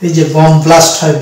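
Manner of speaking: normal talking voice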